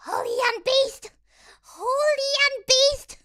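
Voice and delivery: loud, drawn-out cry of disbelief; exasperated shout. Retold or imitated. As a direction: yell the title like it's obvious. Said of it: "And I'm like".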